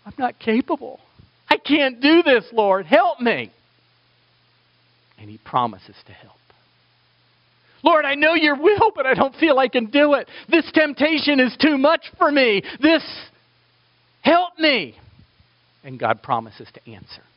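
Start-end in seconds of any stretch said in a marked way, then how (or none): 0.1-3.5 higher, louder desperate plea; pleading prayer. Acted. Preacher voicing a struggling believer begging God, pleading. 7.8-15.0 much higher, louder anguished plea; desperate prayer. Acted. Preacher voicing a tempted believer crying out to God, anguished.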